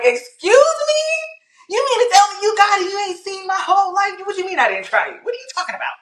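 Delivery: high-pitched, rapid mock tirade; infuriated, retold. Guest re-enacts talking back to God, indignant and infuriated. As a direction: fire off indignant questions in comic outrage. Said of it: "I'm infuriated".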